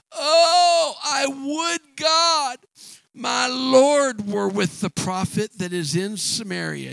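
high, crying plea drawn out slowly; a little slave girl begging her mistress; pleading cry. Acted. Servant girl to her mistress, a high, crying slow plea.

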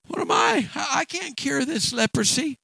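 high-pitched outburst of an upset king. Acted. King to Naaman, a high, upset outburst. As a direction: throw up your hands in disbelief. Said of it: "the king got upset".

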